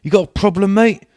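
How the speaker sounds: high, loud squeaky tough guy; mock hard man. Posh friend squaring up to the lads in a squeaky hard-man voice. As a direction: ham up a pint-sized bruiser picking a fight. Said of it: "he's like".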